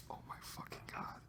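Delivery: barely audible, voiceless whisper; horrified whisper. Retold or imitated. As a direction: whisper it to yourself in mock horror. Retold waking to horrified realisation.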